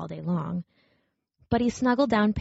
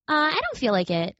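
little rabbit voice; 7 semitones higher than usual. Acted. Shy toy rabbit answers.

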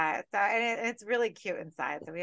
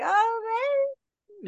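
mock excited reply; 9 dB louder than usual. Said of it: "I'm like".

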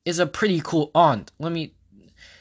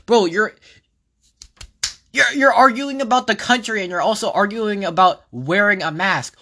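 louder, higher exasperated rant; 5 semitones higher, 4 dB louder than usual. Real, not acted. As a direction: vent your frustration loudly. Ranting at politicians over masks.

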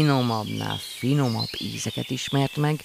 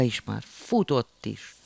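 ordinary delivery, nothing marked

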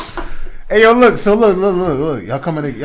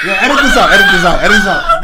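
louder, pleading through real laughter; laughing plea; 3 semitones higher, 6 dB louder than usual. Real, not acted. Laughing plea to the editor to cut it. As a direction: laugh while begging them to cut it.

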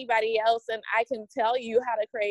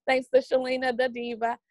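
ordinary talk, nothing marked

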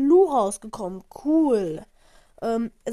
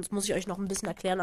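ordinary delivery

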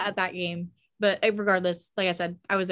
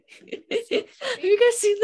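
words dissolving into laughter; laughing; 12 semitones higher, 7 dB louder, much slower than usual. Real, not acted. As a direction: try to speak through laughter. Laughing about the bobblehead.

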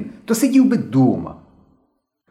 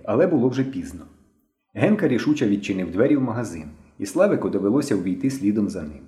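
ordinary delivery

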